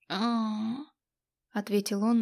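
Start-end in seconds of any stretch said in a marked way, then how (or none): 0.1-0.9 hangover groan. Acted. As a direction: moan it out weakly. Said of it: "стонать я и сама могла". (протяжный стон с похмелья)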